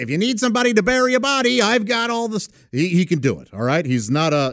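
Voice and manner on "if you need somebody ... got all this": fast, high, oily shady-guy voice; smarmy Aruba Ray. Acted. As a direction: offer a dark favour with a grin. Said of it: "he often say".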